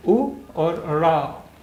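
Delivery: उ and ड़ा drawn out slowly, one at a time; slow syllable by syllable. Shown as a demonstration. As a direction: sound it out slowly for a learner. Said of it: "अब आप इसे पढ़िए".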